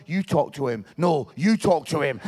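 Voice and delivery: low, urgent imitation of uneasy men; awkward buck-passing. Barber's customers dodge the crying man, mock awkward. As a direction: push the job onto someone else.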